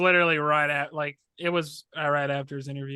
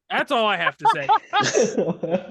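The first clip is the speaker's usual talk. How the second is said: laughing; 4 semitones higher, 5 dB louder, slower than usual. Real, not acted. Laughing sign-off.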